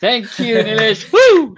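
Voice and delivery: high, loud, whooping thanks; super excited. Real, not acted. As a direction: burst out with real excitement. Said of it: "I'm super excited here".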